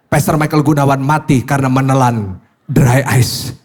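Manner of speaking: a hint of a news anchor reading the headline; mock headline. Pastor imagining his own death in the Jawa Pos, mock headline read. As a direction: announce it like a newspaper headline. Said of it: "akan muncul di Jawa Pos".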